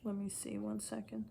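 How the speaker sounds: unvoiced murmured aside while looking something up; whisper to self. Real, not acted. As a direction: whisper it to yourself while searching. Searching quietly for the quote.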